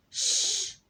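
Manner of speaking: a loud high-pitched shush; teacher's loud shush. Shown as a demonstration. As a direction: shush sharply. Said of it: "حط ايدك على بقك".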